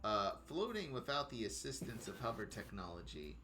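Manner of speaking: low, soft, halting narration; hushed storyteller with long pauses; game master scene setting. A narrator's reading. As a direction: describe the city quietly, feeling your way.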